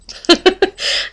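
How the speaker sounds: a quick, high-pitched laugh. Real, not acted. Amused at her own southern accent, a quick high laugh.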